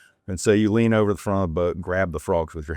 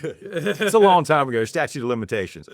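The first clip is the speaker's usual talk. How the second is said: high, louder laughing reply; 7 semitones higher, 4 dB louder than usual. Real, not acted. Joking to the host about the statute of limitations, laughing. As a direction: laugh it off lightly.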